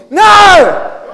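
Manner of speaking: NO belted high and loud; big rallying shout; shout with conviction. Shown as a demonstration. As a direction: lead the church in a forceful no. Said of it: "Say it with conviction".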